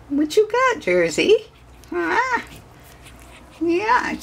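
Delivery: very high coo at a puppy. Real, not acted.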